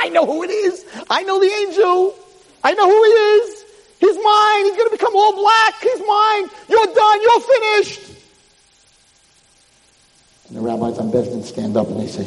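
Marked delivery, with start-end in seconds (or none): 0.0-8.0 high, mounting villainous shout; shrieked triumph of a devil. Retold or imitated. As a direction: crow over your doomed victim.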